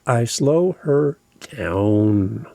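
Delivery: slow right down to a crawl on the last word; drawn-out slowing down. Shown as a demonstration. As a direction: stretch the words out very slowly. Drawing out words about slowing down.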